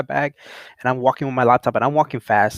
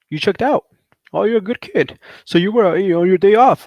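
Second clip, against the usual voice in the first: high-pitched imitation of a cop; mock the officer's placating tone, pitched up; high, louder-ending retold cop voice; officer letting him off; 8 semitones higher, 5 dB louder than usual. Voicing officer after ID check.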